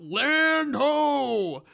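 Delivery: high, loud sailor's cry; Yukon yelling. Retold or imitated. Yukon announcing land after the crash, loud hammed-up cry. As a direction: call it out like spotting shore. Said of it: "Yukon yells out".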